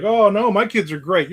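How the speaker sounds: high, gushing mock parent; mock proud parent. Mock parent insisting their kids are great. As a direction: chirp it like a doting parent. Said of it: "everybody's like".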